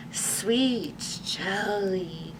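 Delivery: soft, very slow moaning zombie. A narrator's reading. Reginald the zombie moaning softly about jelly. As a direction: moan it dreamily like a hungry zombie. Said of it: "moaned Reginald".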